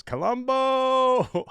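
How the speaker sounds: high drawn-out loud call; shouted name. Acted. Reading a subscriber's shouted message aloud. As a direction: bellow the name like a chant. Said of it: "Aether Zero subscribing Prime says".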